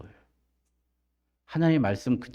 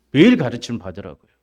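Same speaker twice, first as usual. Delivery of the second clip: usual speaking voice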